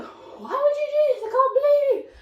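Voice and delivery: hammed-up distraught daughter; upset daughter. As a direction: whine at her dad in disbelief. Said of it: "she's like".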